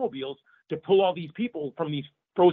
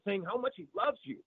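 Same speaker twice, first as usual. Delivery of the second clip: ordinary talk, nothing marked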